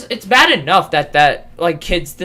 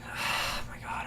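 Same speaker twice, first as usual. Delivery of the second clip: exasperated whisper; 19 dB quieter than usual. Real, not acted. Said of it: "I'm gonna kill myself".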